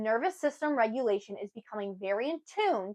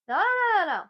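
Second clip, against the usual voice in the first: high, louder string of no's; emphatic no; 9 semitones higher, 3 dB louder than usual. Real, not acted. Waving off blame-thinking with high emphatic no's.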